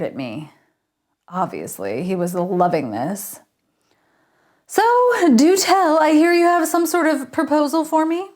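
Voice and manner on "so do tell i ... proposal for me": gloating Richard. A narrator's reading. Richard gloating over the request.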